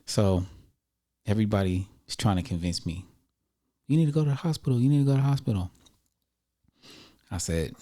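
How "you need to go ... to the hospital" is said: urging. Retold or imitated. Everybody urging him, repeated.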